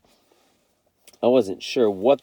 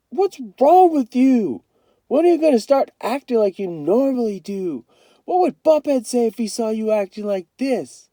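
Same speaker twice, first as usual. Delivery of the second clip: shouting in frustration; 12 semitones higher, 5 dB louder than usual. A narrator's reading. Kenny confronts his brother about acting dull, shouting. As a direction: demand an answer, fed up. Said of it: "I shouted".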